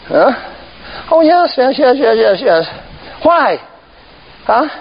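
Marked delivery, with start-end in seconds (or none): none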